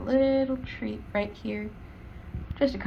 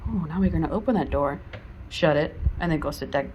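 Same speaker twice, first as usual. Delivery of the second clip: ordinary talk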